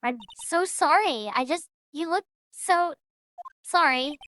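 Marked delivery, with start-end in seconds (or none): none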